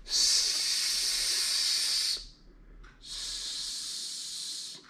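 a hiss that fades almost to nothing; fading snake hiss. Shown as a demonstration. Teacher voicing Simon the snake for children, fading hiss. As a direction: hiss like Simon the snake, then let it die away.

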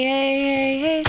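ordinary delivery

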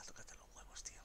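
hushed unvoiced curse; whisper. Real, not acted. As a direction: whisper it under the breath. Spots an alpha raptor.

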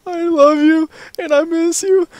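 put-on very high, slow, tearful girl voice; imitating a teary girl. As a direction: mimic a girl sobbing out her love. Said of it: "She was like".

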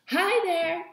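high, loud greeting; cheerful call. Acted. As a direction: call out while bursting in. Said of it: "cried Pippi".

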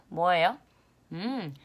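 usual speaking voice

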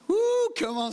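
high excited whoop; preacher whooping to the church, high and loud. Real, not acted. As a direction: let out a loud joyful woo.

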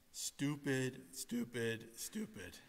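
muttered to himself, fading out; fading self-reproach. Real, not acted. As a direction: scold yourself and let the voice die away. Self-reproach after the mountain mistake.

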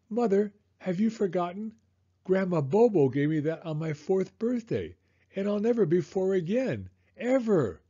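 high, hammed-up child protest; milk it as an aggrieved little boy; dramatic kid. A narrator's reading. Son pleading for grandma's gift, dramatic protest. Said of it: "Don't be so dramatic".

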